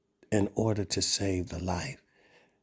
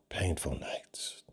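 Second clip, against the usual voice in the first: hushed; 4 semitones lower, 9 dB quieter, slower than usual. Acted. Preacher dwelling on suffering.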